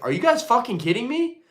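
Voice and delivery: exasperated friend, pitch up; mock disbelief. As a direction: throw it back at them in disbelief. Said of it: "I'm like".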